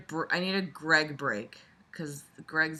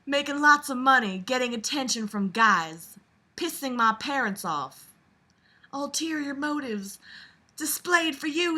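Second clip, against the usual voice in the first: southern belle; 7 semitones higher, 4 dB louder than usual. Acted. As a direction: read it as a dainty Southern belle. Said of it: "I'll do this reading all in Southern Belle voice".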